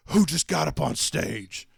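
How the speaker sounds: loud, slow, stern mock security guard; security lady demand. Security lady confronting the stage climber, loud and stern. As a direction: bark it like a guard catching someone. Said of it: "is like".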